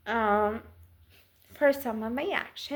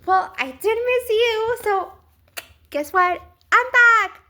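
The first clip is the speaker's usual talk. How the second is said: much higher, louder gloating pest voice; gloating allergies; 9 semitones higher, 10 dB louder than usual. Pretend. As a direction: crow it like a pest that has returned for good. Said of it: "and now for some reason they're like".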